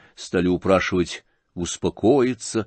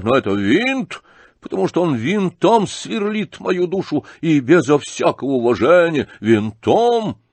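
high, forceful, indignant demand; thunderous tirade; 8 semitones higher, 4 dB louder than usual. A narrator's reading. Outraged old general giving Птицын an ultimatum, thundering. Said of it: "закричал он громовым голосом".

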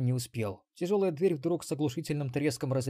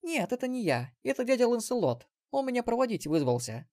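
put-on much higher bright girl voice; young girl; 10 semitones higher than usual. Granddaughter voiced by narrator.

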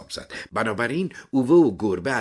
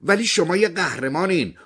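high, raised voice; loud call; 11 semitones higher, 5 dB louder than usual. A narrator's reading. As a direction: call out loudly through a closed door. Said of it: "با صدای بلند گفت". (با صدای بلند و زیر)

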